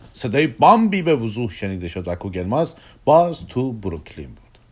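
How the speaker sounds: usual speaking voice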